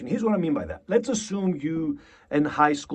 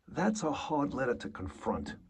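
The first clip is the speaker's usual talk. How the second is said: a soft, stricken curse to himself; stunned realization; 7 dB quieter than usual. Shown as a demonstration. Actor facing a painful letter, softly stricken. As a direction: let the dread land quietly, almost under your breath. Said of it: "You're gonna go".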